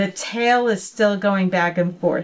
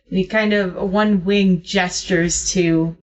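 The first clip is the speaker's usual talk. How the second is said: ordinary delivery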